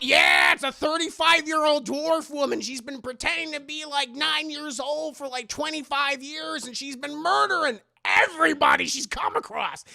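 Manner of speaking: high, hammed-up alarm; imitated urgent caller. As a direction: deliver the warning high and urgent. Imitates caller warning the mother.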